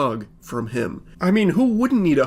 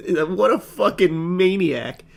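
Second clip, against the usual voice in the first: normal talking voice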